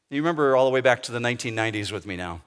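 usual speaking voice

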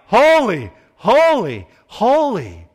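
loud, high cry of praise. Acted. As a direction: call each word out like a proclamation. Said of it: "They were crying out".

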